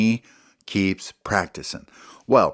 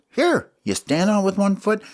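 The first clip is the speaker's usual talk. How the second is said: high little critter voice instructing; Smalls' voice; 11 semitones higher than usual. Acted. Smalls showing Ollie how to stand on the board. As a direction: pitch up and explain. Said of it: "and Smalls goes".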